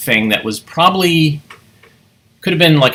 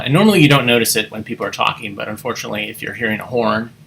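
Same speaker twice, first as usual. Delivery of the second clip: usual speaking voice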